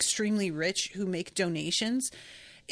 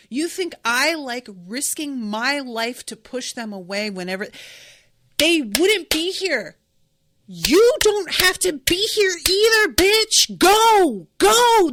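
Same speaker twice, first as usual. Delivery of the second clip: louder and higher, building to a shouted go, go; furious mock retort; 5 semitones higher, 9 dB louder than usual. Mocking the villain's excuse, then shouting her away.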